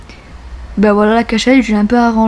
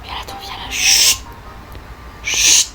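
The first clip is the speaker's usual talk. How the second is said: hushed, secretive whisper; 7 dB quieter than usual. Acted. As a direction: hush them so nobody hears. Hushing a witness. (chuchotement complice)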